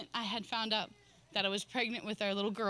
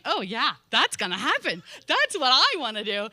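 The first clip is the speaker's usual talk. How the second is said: louder, mock-enthusiastic sarcasm; sarcastic; 8 dB louder than usual. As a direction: scoff at the idea, loud and biting. Mocking the leader's instruction.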